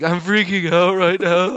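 high-pitched retold panic; freaking out. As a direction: act out a mild comic freak-out. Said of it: "like, freaking out".

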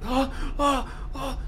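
repeated short high screams; high mock cries; imitated scream. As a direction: act out screaming, held back in volume. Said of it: "empecé a gritar así".

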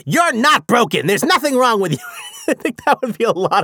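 loud, high-pitched comic squawk; Gilbert Gottfried. Acted. Author imagining a comedian narrating his book, hammed-up loud impression. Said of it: "Gilbert Gottfried".

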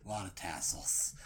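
low, soft, whispered; mournful whisper of defeat; whispering the bad news; hushed lament. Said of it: "He's like".

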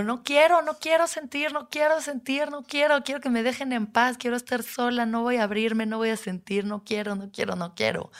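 high, pleading, frightened no quiero; panicked refusal. Retold or imitated. Curled up in therapy, begging not to feel, high and frightened. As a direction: beg to be left alone, pitch well up.

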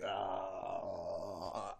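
blank, stunned exclamation; a startled, lost little "ah". Retold or imitated. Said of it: "And I was like".

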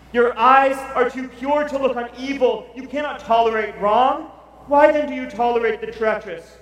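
loud, high, ringing cry to God; full-force preaching. Acted. Preacher crying out Habakkuk's prayer to the Lord, loud and ringing. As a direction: proclaim the prophet's complaint at full force.

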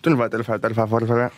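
lightly voiced auctioneer. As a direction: rattle off bids like an auctioneer. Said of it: "you're gonna do, um, an auctioneer".

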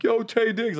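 high, loud fan holler; mock attention-grabbing call; big-deal shout-out. Shown as a demonstration. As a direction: hail a star across the club. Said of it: "didn't wanna make a big deal".

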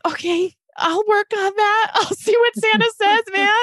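high-pitched, tearful acted reply; choked-up mom. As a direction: agree bravely through a tight, high voice. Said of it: "It ripped out my heart".

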